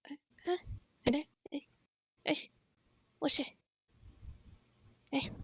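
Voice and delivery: quiet furtive hey-there from the shadows; sneaky hushed beckoning; hushed calling out. Acted. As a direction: call softly from hiding. GM voices a lurker calling out.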